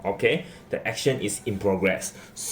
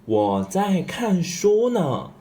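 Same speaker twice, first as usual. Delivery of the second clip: slow model reading; 8 semitones higher, slower than usual. Shown as a demonstration. Reading the reply slowly.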